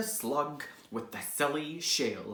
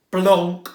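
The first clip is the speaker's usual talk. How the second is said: thud blonk; 4 semitones higher, 15 dB louder than usual. Acted. Sound effect landing.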